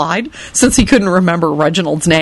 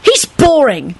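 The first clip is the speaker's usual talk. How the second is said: put-on high-pitched, irritable child; 8 semitones higher, slower than usual. A narrator's reading. Tim judges the dead man, irritably. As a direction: announce it crossly, pitched up. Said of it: "Tim announced irritably".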